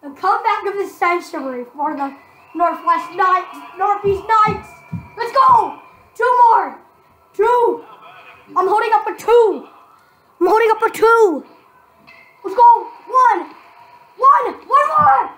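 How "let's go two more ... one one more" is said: loud excited yelling; shouting. Real, not acted. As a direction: yell for the last outs. Cheering for final outs.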